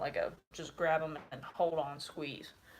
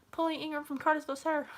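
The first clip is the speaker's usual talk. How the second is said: shouted recruit reply; 11 semitones higher, faster than usual. Retold or imitated. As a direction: bark your name at attention. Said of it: "And so you say".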